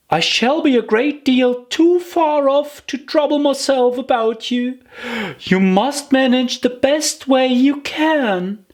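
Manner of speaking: put-on high, wondering girl's voice; a small girl fretting fondly over her far-off feet; Alice's voice. Alice bidding farewell to her own feet, high and fond.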